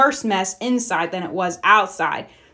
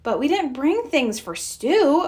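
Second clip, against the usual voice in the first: objecting; 6 semitones higher than usual. A narrator's reading. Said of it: "objected Mama".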